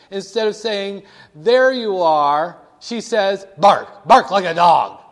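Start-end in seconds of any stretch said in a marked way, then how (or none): 1.4-2.6 waitress serving. Acted. Waitress quote in burger story. 3.6-5.0 loud, slow mock bark; a waitress serving up the plate with a loud, drawn-out bark. Waitress to diner at the table, slow loud mock barking. Waitress quote in burger story.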